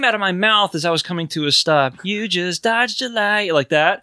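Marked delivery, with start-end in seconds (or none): 2.0-3.5 "You just dodged a light" slow, sung up and down; sing-song intonation. Shown as a demonstration. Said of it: "I intonated it like that".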